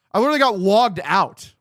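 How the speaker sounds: loud, high burst of disbelief; exasperated outburst. Real, not acted. Streamer logged out mid game, loud exasperated outburst. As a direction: vent it at the screen, fed up.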